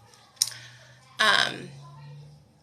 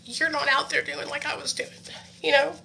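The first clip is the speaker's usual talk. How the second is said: teary-eyed; 5 semitones higher, 6 dB quieter than usual. Real, not acted. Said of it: "I got a little, um, teary-eyed".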